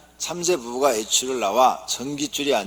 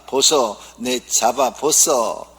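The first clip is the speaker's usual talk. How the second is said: Gyeongsang wife teasing; 7 dB louder, much slower than usual. Acted. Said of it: "경상도 아내가".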